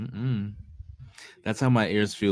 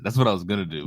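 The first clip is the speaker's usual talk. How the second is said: usual speaking voice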